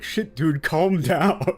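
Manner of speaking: laughing; exasperated. Retold or imitated. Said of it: "That makes you go like".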